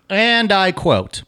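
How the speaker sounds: high, drawn-out quote intro; mock-grand announcement. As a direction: announce it with slow, lofty ceremony.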